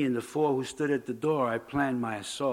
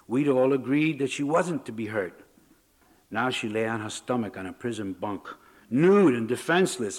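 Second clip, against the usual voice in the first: ordinary delivery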